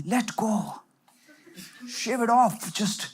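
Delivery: hushed, airy slogan; half-whispered, drawn out at the end; mock soothing mantra. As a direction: softly imitate a let-it-go guru. Quotes the let-go narrative mockingly.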